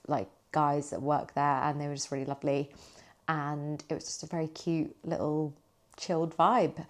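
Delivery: ordinary talk, nothing marked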